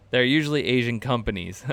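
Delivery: usual speaking voice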